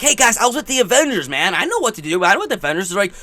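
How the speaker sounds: high, quick excited imitation; eager retelling. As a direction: imitate an eager kid bragging to the guys. Acting out Spider-Man's eager boast.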